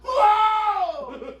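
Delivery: long, high, loud howl; re-enacted yell. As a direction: let out a drawn-out mock-outraged holler. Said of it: "That's exactly what I did".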